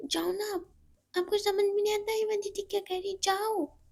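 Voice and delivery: put-on high, fast girlish scolding; a kid sister snapping at a stranger; little Chinki telling the man off, high; young girl voice.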